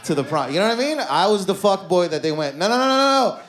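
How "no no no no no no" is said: high, loud run of no's; alarmed refusal. Retold or imitated. Exes swearing off the fuck boy, alarmed refusal. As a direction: rattle off panicked, mock-horrified refusals. Acted exes recoiling from him.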